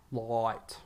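"Light" with a long drawn-out eye sound; stretched vowel. Shown as a demonstration. Podcaster correcting his mate, stretching the eye in "Light". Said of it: "stretch out the eye".

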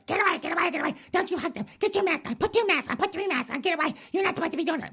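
very high, far put-on Karen voice; shrill falsetto Karen scolding about masks; covid Karen shriek. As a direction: screech it like a panicked mask-obsessed Karen. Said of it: "You don't want covid Karen going".

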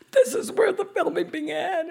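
thrilled remembering out loud; delighted exclaim. Retold or imitated. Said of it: "I went".

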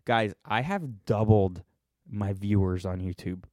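usual speaking voice

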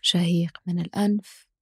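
slow, calm breathing cues; guided breathing. Acted. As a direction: count the breath slowly and softly.

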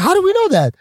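squeaky, baffled question; high incredulous protest. Retold or imitated. Newcomer asking comics how he could know, high and disbelieving.

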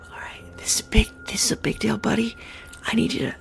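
hushed whisper to a companion. Acted. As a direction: whisper it like a confidential briefing. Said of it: "And I whisper to him".

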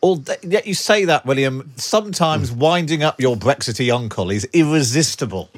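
usual speaking voice

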